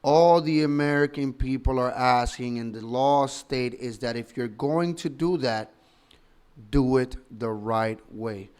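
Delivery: normal talking voice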